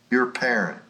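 normal talking voice

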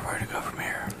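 whispered echo of the question; mock-secret whispered repeat. Shown as a demonstration. Line repeated then whispered.

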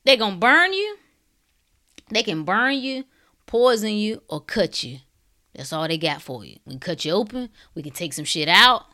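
ordinary talk

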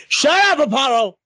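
sharp, high, loud scolding; sudden high-pitched shout; real yell. Real, not acted. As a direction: yell to shut someone up. Yelling at the dog mid-show.

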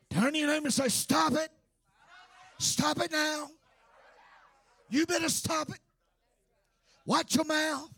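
fiery sermon rebuke; full-force preaching. Acted. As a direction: preach it at the top of your voice.

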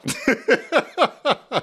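high-pitched burst of laughter. Real, not acted. Teacher amused by own kiss example, laughing.

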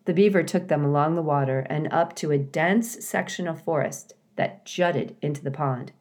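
ordinary delivery

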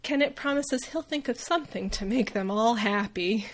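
ordinary talk

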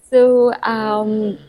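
giggling through the words; laughing. Real, not acted. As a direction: caught laughing, high and breathy. Laughing as colleague Jay appears.

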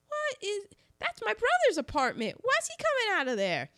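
high-pitched, quick incredulous questions; mock alarmed surprise. Reviewer acting out friends spotting a stranger, high mock alarm. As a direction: imitate startled friends, voice jumping up. Acts suspicious friends in retold scene.

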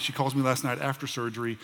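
normal talking voice

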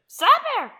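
very high, loud raven squawk; piercing bird-like scream. A narrator's reading. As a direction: shriek it out like a raven. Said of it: "screamed the Raven".

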